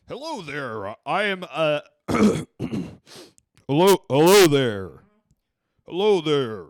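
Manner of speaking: loud, slow, grand greeting; dream voice. Shown as a demonstration. Trying out the voice he wishes he had, loud and slow. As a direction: boom out the hello like a showman. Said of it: "what you would want your voice to be".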